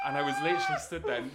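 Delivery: high cracking laugh through the words; laughing squeal. Real, not acted. Cracking up after the quote.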